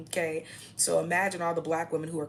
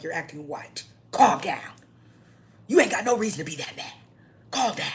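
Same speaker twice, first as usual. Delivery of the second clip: mock scolding; 3 semitones higher, 8 dB louder than usual. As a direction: scold them to calm down. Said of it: "I be like".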